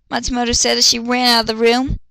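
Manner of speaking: ordinary talk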